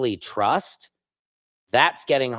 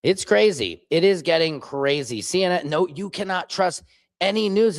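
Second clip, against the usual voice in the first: ordinary talk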